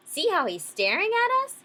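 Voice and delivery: high, loud, irritated fish; irritable. Acted. Grumpy fish complains to the others about the staring whale, irritably. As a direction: complain sharply with the pitch pushed up. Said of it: "he went on irritably".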